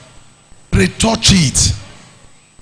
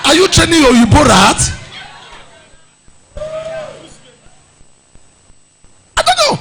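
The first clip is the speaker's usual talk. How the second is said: mock scolding shout; 7 semitones higher than usual. Preacher scolding ladies about their hair, shouting. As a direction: scold them for neglected hair.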